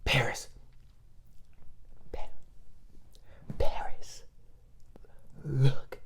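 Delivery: soft urgent whisper to sister; whispering to get sister's attention. Retold or imitated. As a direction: hiss her name across the room. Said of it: "I'm like".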